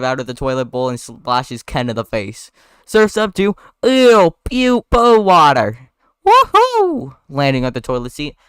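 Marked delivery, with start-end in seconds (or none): 2.9-5.9 high, loud disgusted cry; hammed-up revolted exclamation; disgusted outburst. Acted fight, splashed with toilet water. 6.3-7.2 very high, loud whoop; gleeful whoop. Acted. Barry surfing, whoops.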